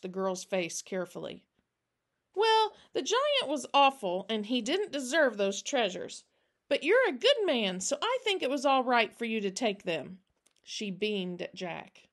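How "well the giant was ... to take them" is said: put-on higher, louder child voice, approving; young girl giving her honest verdict; earnest little girl. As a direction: reassure him with a child's certainty.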